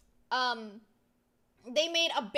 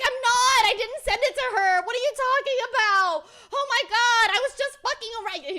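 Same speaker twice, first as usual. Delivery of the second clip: loud, high-pitched frantic yelling; hammed-up protest; 11 semitones higher, 11 dB louder than usual. Streamer acts out the friend's panicked denial, yelling. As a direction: yell the denial with a laugh creeping in. Retold quarrel at its peak.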